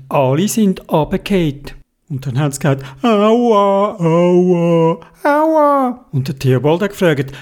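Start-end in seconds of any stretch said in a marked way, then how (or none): 3.0-6.1 drawn-out ows, the last much higher; mock hurt moaning, stretched out; wailing in pain. All riders fell off at the rock, plaintive wailing ows.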